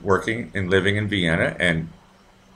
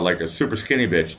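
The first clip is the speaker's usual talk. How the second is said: ordinary talk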